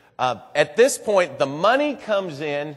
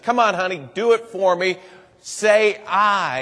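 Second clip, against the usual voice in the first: put-on higher, brighter coaxing to a child; mother coaxing her daughter to speak, a little higher; 5 semitones higher, 6 dB louder than usual. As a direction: gently urge her to try.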